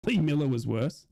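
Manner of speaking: ordinary talk, nothing marked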